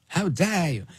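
Greta Thunberg. Retold or imitated. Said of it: "like Greta Thunberg".

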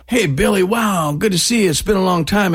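high, effusive mock greeting; acted fan greeting. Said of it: "you say".

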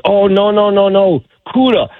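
high-pitched imitation of a Bahamian guide; Bahamian accent. Bahamian guide warns his client of a barracuda, high imitated Bahamian accent. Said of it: "in that Bahamian accent".

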